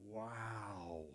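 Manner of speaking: hushed, drawn-out wow; stunned awe. Retold or imitated. Soldier finding his buddy gone, hushed shock. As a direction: let it out softly, in shock. Stunned realisation after the blast.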